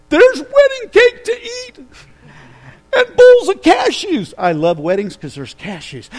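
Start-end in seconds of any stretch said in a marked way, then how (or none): 0.1-4.3 excited laughing squeal. Real, not acted. Joking about wedding treats.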